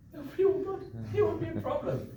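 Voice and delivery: high, carefree self-reassurance; breezy inner voice. Retold or imitated. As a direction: airily talk yourself out of it. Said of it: "And I thought".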